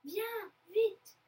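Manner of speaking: high, loud, urgent call; sudden exclamation. A narrator's reading. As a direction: urge someone along excitedly. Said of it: "s'exclame soudain le père".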